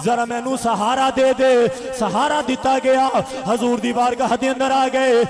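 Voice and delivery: loud, ringing sermon chant; full-force preaching. A narrator's reading. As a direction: preach the battle story at full volume. Preaches the wounded man's return.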